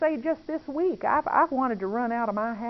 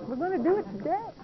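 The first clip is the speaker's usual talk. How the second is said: higher and faster insistent repeat; firmer repeat; 6 semitones higher, 3 dB quieter, faster than usual. Retold or imitated. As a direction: push it a bit higher and quicker. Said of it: "He said".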